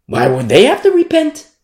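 ordinary delivery

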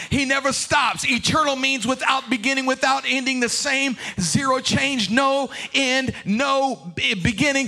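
rhythmic build, rising in pitch and force to a shouted climax; mounting preacher's cadence; impassioned preaching. Real, not acted.

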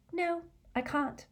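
high, soft, hesitant answer; slow reply. A narrator's reading. Hermione conceding the point to Harry, slow reply. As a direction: answer high and softly, as if reluctant. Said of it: "she answered slowly".

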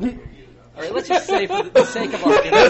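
high, loud laughter through the words; can't stop laughing while talking. Real, not acted. Cracking up mid-sentence.

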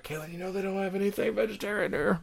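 leaning over to warn Kaylin, softly; leaning-in murmur. Retold or imitated. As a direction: tip her off quietly at the table. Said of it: "I was like".

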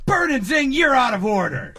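angry boss yelling. Acted. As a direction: yell it at your employees. Boss line, take two.

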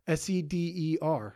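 letter by letter, S E D E R; spelling it out. Shown as a demonstration. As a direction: spell out Seder, each letter separate. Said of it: "Saturday Night Seder?".

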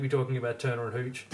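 ordinary talk

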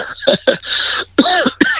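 high, breathy giggle; amused laugh. Real, not acted. Speaker tickled by being called Savage A F, high amused laugh. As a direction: giggle at the joke.

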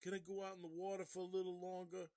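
put-on high, fast, pleading kid in a northern accent. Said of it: "that's my northern voice".

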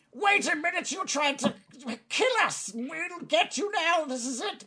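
high-pitched goblin outrage; alarmed angry goblin. Acted. Goblin realising intruders want to kill him, high alarmed voice.

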